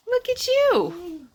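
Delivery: very high, slow cooing praise. Real, not acted. Mother praising baby.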